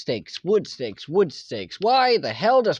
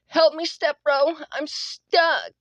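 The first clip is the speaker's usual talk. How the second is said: put-on much higher, breathy-light girly voice; girl voice impression; 7 semitones higher, slower than usual. Teasing his teammate, doing a higher girl voice. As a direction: play a helpless girl asking for help. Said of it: "do a girl voice impression".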